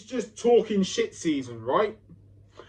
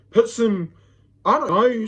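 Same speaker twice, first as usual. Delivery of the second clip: normal talking voice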